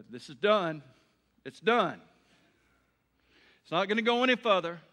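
loud, punched preaching, pushing ahead; preaching at full force. Acted. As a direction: hammer every done home. Preacher hammers the point home.

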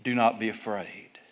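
soft, low, with long pauses; calm a frightened father, sinking almost to a hush; quiet, measured comfort; gentle reassurance. Acted.

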